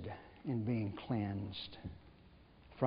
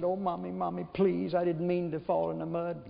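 put-on a mildly higher little boy begging mommy; scared pleading child; 5 semitones higher than usual. Preacher voicing a muddy little boy begging his mother, mildly higher and pleading. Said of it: "he's scared to death, and he said".

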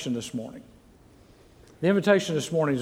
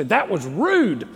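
louder, higher, drawn-out parent telling-off; sharp parental scolding; 4 semitones higher, 4 dB louder, slower than usual. Acted. Parent scolding a child on the way home, sharp telling-off. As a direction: scold the child hard, stretching the words. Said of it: "usually you don't say it like that. You say".

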